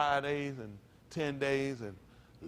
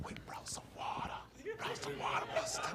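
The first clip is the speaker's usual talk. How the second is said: hushed, voiceless mock whisper; exaggerated funeral whisper; 9 dB quieter than usual. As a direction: whisper it over and over, solemnly. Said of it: "Why are you whispering".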